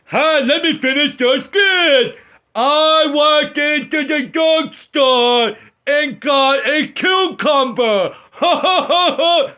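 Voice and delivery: high, loud, slow dopey voice with cackles; dumb writer. Pretend. Mocking the dumb screenwriter, silly high voice with laughs. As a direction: play a dim-witted writer laughing at his own idea. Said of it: "Dum Dum Jojo comes walking around".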